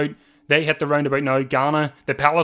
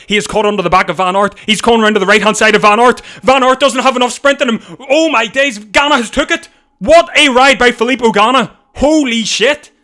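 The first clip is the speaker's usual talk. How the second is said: shouting higher and higher as the sprint unfolds; carried-away screaming; 8 semitones higher, 8 dB louder than usual. Real, not acted. Streamer screaming to viewers as Ganna snatches the win. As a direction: lose it completely as the rider steals the win. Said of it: "I was got a bit carried away".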